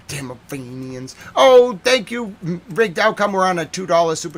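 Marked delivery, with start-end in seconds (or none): 1.3-2.4 high, loud, slow delighted oh; excited thanks. Real, not acted. Streamer thanking a super chat donor, delighted. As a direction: burst out gratefully.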